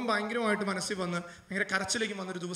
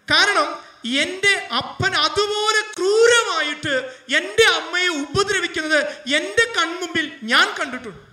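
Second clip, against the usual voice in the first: loud, rising sermon voice, slowing down; a fervent pulpit cry; impassioned preaching; 8 semitones higher, 8 dB louder, slower than usual. Acted. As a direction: preach it with full force. Preacher recounts father's cruelty.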